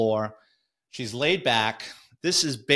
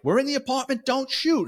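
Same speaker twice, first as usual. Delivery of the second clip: much higher, pleading call through a door; urgent calling out; 13 semitones higher than usual. Retold or imitated. Cop inside the apartment warning colleagues at the door, high urgent call. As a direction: call out so your own side won't fire.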